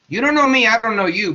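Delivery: high, loud mock announcement; loud self-introduction. Wallace introduces himself to Darwin by letter, loud mock announcement. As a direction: burst in like a stranger calling out.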